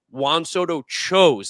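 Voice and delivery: slow, emphatic landing on "chose"; stress on chose. Real, not acted. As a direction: lean hard and long on "chose". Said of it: "that's so important to say".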